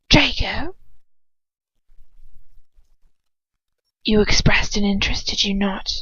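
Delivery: cold hissing whisper of a dark lord; Voldemort whisper. Acted. Voldemort deciding who to send, in a cold whisper.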